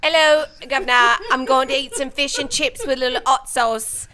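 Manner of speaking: hammed-up British "Governor" voice; British accent. Guest orders British food in a hammy British accent. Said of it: "when I do British accent".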